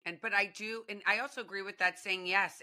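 ordinary delivery

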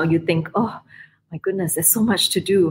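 ordinary delivery

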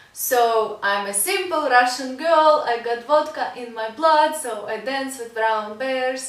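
put-on heavy Russian accent, recited like a poem; strong Russian accent. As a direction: lay on a thick Russian accent. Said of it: "Yes, I love doing very strong Russian accent".

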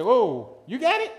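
high, loud mock disbelief; surprised. Young [name] seeing another receive the Spirit, surprised. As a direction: squeak out the surprise. Retold envy at prayer meeting.

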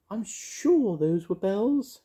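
high, slow plaintive fairy voice; mournful high-pitched fairy; sad fairy. A narrator's reading. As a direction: draw it out wistfully in a tiny high voice. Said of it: "said Mavis sadly".

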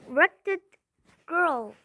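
loud, high furious cry; angry cry. A narrator's reading. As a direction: spit it out in a rage. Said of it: "she cried".

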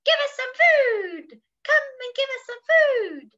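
high, loud squawking demand; high, clamouring bird voice; crying parrots. Acted. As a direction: screech for food like a parrot. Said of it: "cried the hungry parrots".

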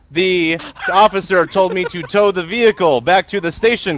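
slow, deliberate statement. Real, not acted. Tow driver giving his statement to student crew, slowly. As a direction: measure out each word clearly. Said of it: "All right, slower and louder".

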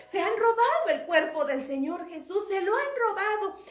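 distressed alarm. Acted. Mary telling the disciples of the stolen body, distressed alarm. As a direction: break terrible news urgently.

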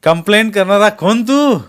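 loud, high, scornful question; angry challenge. A narrator's reading. Masked man mocks Jaydeep's threat to complain, shouting angrily. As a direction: throw his threat back at him, shouting.

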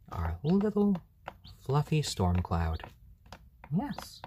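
high, slow hushed coo; soothing pet voice. Real, not acted. Soothing the sleepy chick Stormy, high and hushed. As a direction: lull the fluffy chick to sleep. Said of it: "keep my voice just calm and soothing".

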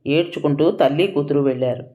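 ordinary delivery

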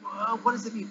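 ordinary delivery

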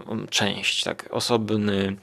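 usual speaking voice

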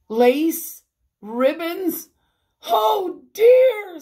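loud, rising shocked questions; hammed-up astonishment, pitch soaring; horrified disbelief. A narrator's reading. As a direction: climb into a high wail of dismay. Mrs Rogers horrified at trimmed meat.

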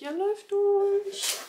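high, slow, amazed. Real, not acted. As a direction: stretch it out in wonder. Repeats in amazement.

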